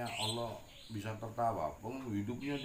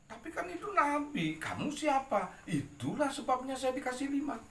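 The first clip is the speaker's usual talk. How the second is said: much higher, louder cheeky bluff; cheeky quote; 12 semitones higher, 6 dB louder than usual. Acted. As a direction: sell the clever excuse with glee. Abu Nawas bluffs the king.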